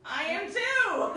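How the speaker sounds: loud, high, drawn-out protest; slow, emphatic comeback breaking into laughter; mock-indignant retort. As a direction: protest loudly, half laughing.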